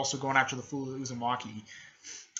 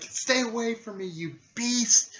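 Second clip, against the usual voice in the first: high-pitched, acted warding-off cry; frightened yell; 9 semitones higher, 4 dB louder than usual. Afraid teammate warning off a beast, frightened yell. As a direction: start high and get louder to the end. Afraid girl warns off character.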